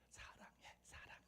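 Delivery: urgent little stage whisper; feed him the words under your breath; imitating a friend whispering the cue; whispered prompting. Colleague prompts beside the phone.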